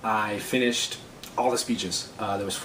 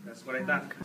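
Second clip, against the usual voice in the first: soft put-on Mexican accent; fake Mexican accent; 7 dB quieter than usual. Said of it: "my accent is in fact fake".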